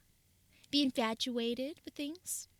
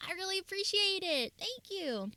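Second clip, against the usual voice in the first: high squeaky gremlin voice; bright chirpy streamer gremlin voice; 10 semitones higher than usual. Real, not acted. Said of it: "I'm trying to minimize my gremlin voice".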